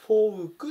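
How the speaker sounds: "fouk", the vowel sounded out loudly; folk as "fouk". Shown as a demonstration. Teacher telling folk from fork, clear o-u in folk. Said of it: "っていうふうに発音するので".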